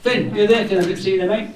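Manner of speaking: suddenly spot him and call out; pleased surprise at seeing him; surprised call out. Real, not acted. Spotting Finn in the crowd.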